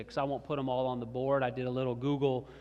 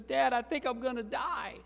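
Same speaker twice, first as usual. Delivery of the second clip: put-on high little-boy voice, mildly upset; distressed three-year-old; 10 semitones higher than usual. Three-year-old Sammy tells Dad his fear, distressed. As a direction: imitate a small child in mock distress. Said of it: "starts to turn almost hysterical and screaming".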